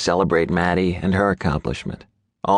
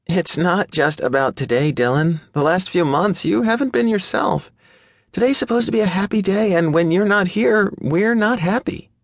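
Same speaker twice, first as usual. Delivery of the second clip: high pleading woman's voice; hurt young woman; 14 semitones higher than usual. A narrator's reading. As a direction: tell him he's been missed. Maddie voiced in story.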